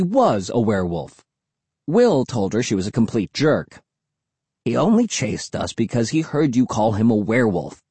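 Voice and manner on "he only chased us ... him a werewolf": ordinary delivery, nothing marked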